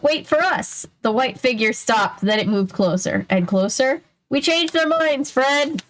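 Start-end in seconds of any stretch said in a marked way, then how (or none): 0.0-0.9 high, calling out to Fred; Shaggy calling out. Acted. 4.3-5.8 high, calling out to Fred; Shaggy calling out. Acted.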